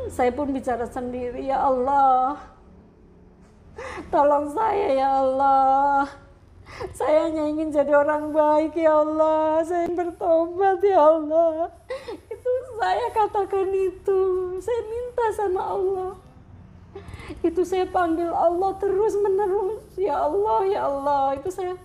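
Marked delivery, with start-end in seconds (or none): 1.5-16.1 real sobbing prayer, pitch climbing; tearful, desperate pleading that carries into the telling; crying plea. Real, not acted. Reliving her prayer, breaking into tears. 17.5-21.8 high, tear-choked telling; tearful. Real, not acted. Still crying as she tells it.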